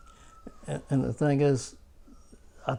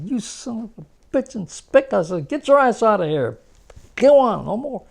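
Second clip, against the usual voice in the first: angry yell; 8 semitones higher, 6 dB louder than usual. Retold or imitated. Chasing the sleeping guerrilla off.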